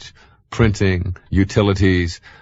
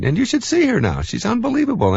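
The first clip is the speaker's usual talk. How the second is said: much higher, gushing with pride; praising his wife's bowling, high and proud; proud, excited. Real, not acted. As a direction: boast about her like she's a star. Bragging about his wife's bowling.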